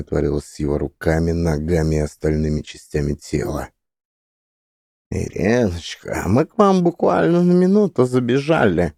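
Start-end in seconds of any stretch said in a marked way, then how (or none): 5.1-8.9 high, bubbling shadow creature voice; gurgling creature. A narrator's reading. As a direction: gurgle it through melting lips. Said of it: "пробулькало существо исчезающими губами".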